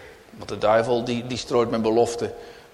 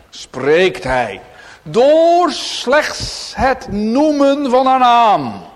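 loud, slow, rising sermon voice; fervent pulpit proclamation; preaching at full force; 6 semitones higher, 8 dB louder, slower than usual. Acted. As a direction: proclaim it to the congregation with weight.

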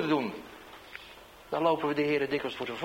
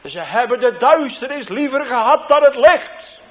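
much louder and higher, shouted across the square; calling out; 9 semitones higher, 14 dB louder than usual. Retold or imitated. Converted heckler shouts the Bible verse across the market, loud. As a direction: call it out for the whole market to hear. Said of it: "hij riep".

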